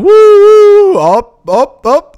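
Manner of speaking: high, loud thrill-ride whoop; roller coaster whoop. Pretend. Host mimics a roller coaster for listeners, high loud whoop.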